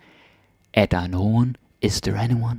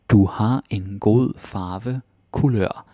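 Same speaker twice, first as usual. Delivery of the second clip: slow for learners; much slower than usual. Shown as a demonstration.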